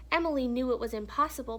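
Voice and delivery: ordinary delivery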